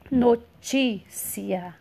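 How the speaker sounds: "Notícia" very slowly by syllables, strong TÍ; stressed middle syllable. Shown as a demonstration. Teacher showing the tonic syllable, "Notícia" with drawn-out TÍ. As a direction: stretch and punch TÍ in "Notícia". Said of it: "sílaba tônica ti".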